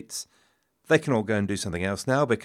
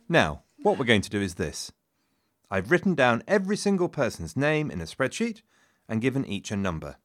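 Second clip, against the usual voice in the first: ordinary talk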